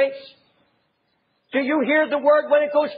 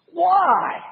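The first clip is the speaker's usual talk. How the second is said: exasperated; 3 semitones higher than usual. Retold or imitated. Preacher voicing Jesus pleading with unbelievers, exasperated. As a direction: throw up your hands in exasperation. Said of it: "see the exasperation there".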